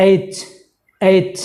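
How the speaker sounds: এইচ ending in a hard, pressed ch; correct pronunciation. Shown as a demonstration. Teacher modelling the letter after correcting it, firm ch ending. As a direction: put pressure on the final চ of এইচ. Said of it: "চর উপরে একটা pressure পড়বে".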